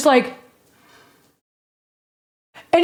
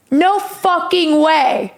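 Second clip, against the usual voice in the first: slow, stretched-out astonishment; imitating stunned disbelief; 2 semitones higher, much slower than usual. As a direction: draw out each word in disbelief. Fan stunned at recognizing her.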